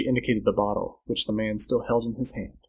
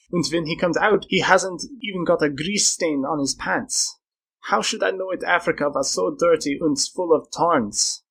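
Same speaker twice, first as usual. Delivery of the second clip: higher-pitched, indignant complaining man; aggrieved traveller; 5 semitones higher than usual. Acted. Man grumbling to friends about his dirty suit, aggrieved. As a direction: complain in a raised, put-upon character voice.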